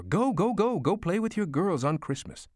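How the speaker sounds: very high, urgent, delighted urging; excited shooing. A narrator's reading. Mother sends son to his girls, excitedly shooing. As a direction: shoo him out in a high voice. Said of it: "shooing me toward the door".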